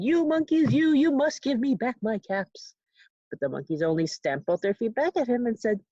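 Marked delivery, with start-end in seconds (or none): 0.0-2.7 a storyteller's hammed-up shout; mock shout. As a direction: yell at the monkeys in the tree. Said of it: "and shouted".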